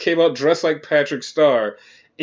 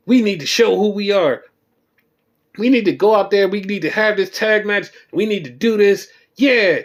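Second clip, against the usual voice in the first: mock hyped-up; 4 semitones higher than usual. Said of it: "And they're all hyped up".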